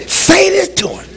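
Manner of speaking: high, slow, drawn-out coaxing; voiced imitation of an urging little demon; mock whispering tempter. Demon perched on your shoulder egging on a spouse, mock coaxing. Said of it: "They're whispering".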